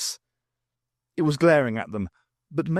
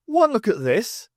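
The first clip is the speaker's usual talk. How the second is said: smug showman; 9 semitones higher, 5 dB louder than usual. Acted. Fitz boasts, showing off lighter.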